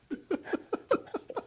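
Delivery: high airy burst of laughter; breathless laugh. Real, not acted. Laughing after telling of bawling.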